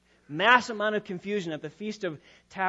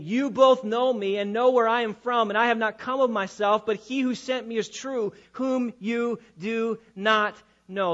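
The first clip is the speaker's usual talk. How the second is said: raised, emphatic proclamation; higher, forceful declaration; crying out; 4 semitones higher, 6 dB louder than usual. Acted. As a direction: cry it out to the temple crowd. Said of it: "cried out in the temple".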